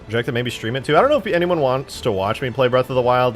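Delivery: ordinary delivery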